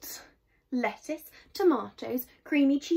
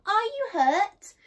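high, loud questioning call; calling out anxiously; 9 semitones higher, 7 dB louder than usual. A narrator's reading. Mum checks on her son from afar, calling. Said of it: "called Mum".

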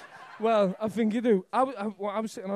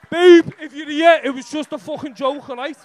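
calling out; 4 semitones higher, 13 dB louder than usual. Real, not acted. Calling out to girlfriend.

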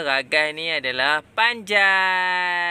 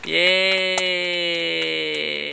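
cheering; 3 semitones higher, 4 dB louder, slower than usual. Real, not acted. Praising both kids' right answers.

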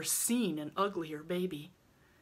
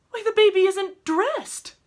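very high, loud outburst of shock; shocked exclamation; 10 dB louder than usual. A narrator's reading. Rilla finds the baby undressed, shocked. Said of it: "exclaimed in a shocked tone".

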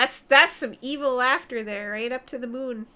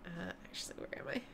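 hushed unvoiced aside; barely audible, much softer; whisper; 5 semitones lower, 17 dB quieter, faster than usual. Real, not acted. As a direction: drop to a whisper, talking to yourself. Sudden quiet muttering to self.

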